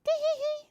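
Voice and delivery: high, tittering tee-hee-hee; squeaky mocking giggle; dog giggle. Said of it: "A little dog laughed".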